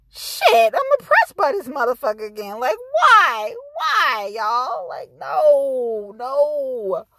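worked-up, drawn-out disbelief; exasperated. Retold or imitated. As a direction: wail it in mock despair at being wronged. Acted reaction to watching the video.

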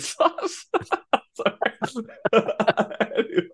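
breaking into real laughter while talking; laughing. Real, not acted. Cracking up over the pizza joke, laughing. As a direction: try to get the words out through giggles.

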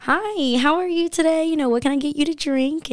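high, bright customer-service sing-song; server voice. Retold or imitated. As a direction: turn on the sweet waitress voice for a new table. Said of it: "you have to develop a server voice".

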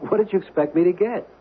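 high and rattled, out of film; defensive and rattled, voice jumping up; flustered. Acted.

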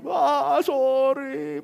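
high, wailed imitation of a child; mock crying apology. Son returning to apologise a second time, high mock wail. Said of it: "Kedua kali datang lagi".

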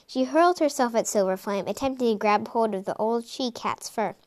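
ordinary delivery

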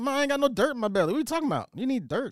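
squeaky mock protest; high-pitched childish protest. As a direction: plead it up high like a kid arguing with mama. Acting out himself arguing with mama.

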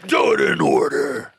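low heavy-metal growl; deep, rough metal vocal. Pretend. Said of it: "that's my metal voice".

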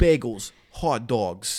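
loud hammed-up New York accent. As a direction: shout it like a Brooklyn street vendor. Keeps doing New York accent.

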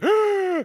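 loud high gasp after the rant; big gasp for air. Retold or imitated. Ace finishing his list out of breath: loud gasp. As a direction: gulp air like you ran out. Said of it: "all in one breath".

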